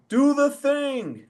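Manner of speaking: high, slow call down from up high; retold call from the rafters. Promoter calls down from the rafters to the ref, high drawn-out call. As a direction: mimic a promoter calling down to the ring. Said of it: "you hear Todd go".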